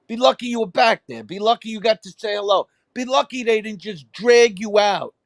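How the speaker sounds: mock outrage, voice up high; retold furious rant. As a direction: tear into the guy, pitch climbing.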